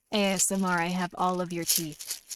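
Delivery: lightly hushed ASMR threat; mock ASMR.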